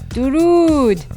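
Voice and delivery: usual speaking voice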